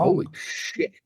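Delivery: mock gasping at the huge ship; hushed, high mock amazement; awed whisper. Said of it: "You're like".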